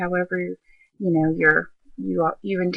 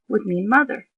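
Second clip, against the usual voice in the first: normal talking voice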